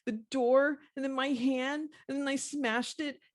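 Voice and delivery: high-pitched toddler retelling, imitated; two-year-old girl. Crying little girl telling how she hurt her hand, dramatic toddler. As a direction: mimic a small child reliving the drama. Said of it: "telling her little two-year-old words".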